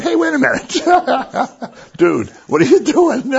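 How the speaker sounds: high, slow, laughing protest; mock indignant Mary. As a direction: play Mary's alarmed protest through laughter. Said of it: "think she would have said".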